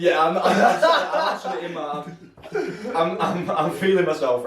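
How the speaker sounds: high, laughing and embarrassed. Real, not acted. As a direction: laugh it off, a bit sheepish. Laughing about feeling himself.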